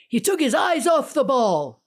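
sportscaster quote. Retold or imitated. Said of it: "what do they say?".

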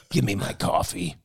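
a lightly voiced cranky old regular; grumpy old man. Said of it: "my other coffee voice".

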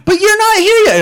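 high-pitched mock kid whine; Jake Lloyd freaking out. Kid protesting that dad isn't home, mock high child voice. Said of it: "He gets Jake Lloyd".

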